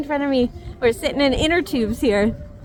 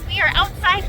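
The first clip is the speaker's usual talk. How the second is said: ordinary talk, nothing marked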